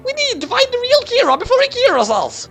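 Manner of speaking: very high English butler in panic; panicked British butler. A narrator's reading. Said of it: "Watari panicked".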